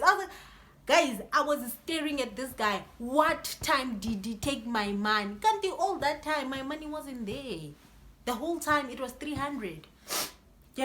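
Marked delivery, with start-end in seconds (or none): none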